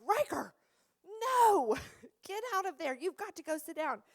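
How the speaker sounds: put-on shouted at a child with a laugh in it; startled mock yell. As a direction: call the kid away from the knobs, half laughing.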